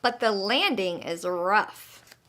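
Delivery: plain reading voice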